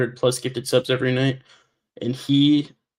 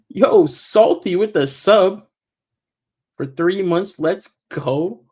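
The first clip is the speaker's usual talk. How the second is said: loud, slow, higher hype call; hyped shout-out; 6 semitones higher, 6 dB louder, much slower than usual. Real, not acted. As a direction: stretch it out like a hype man. Hyping a new subscriber.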